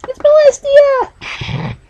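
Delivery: excited squeal. Real, not acted.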